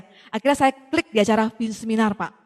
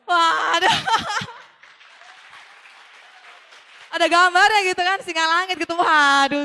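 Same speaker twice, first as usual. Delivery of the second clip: laughing; 5 semitones higher, slower than usual. Real, not acted. Sees the product on the shelf.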